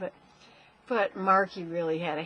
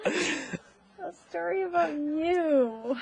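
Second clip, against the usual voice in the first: laughing; 8 semitones higher, 5 dB louder, much slower than usual. Real, not acted. Mother repeats, laughing.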